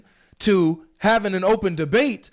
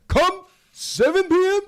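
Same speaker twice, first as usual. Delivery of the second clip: lightly voiced preacher challenge, higher; a hint of a preacher daring him; Sheikh Uthman impression; 10 semitones higher than usual. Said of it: "That's a pretty good Sheikh Uthman impression".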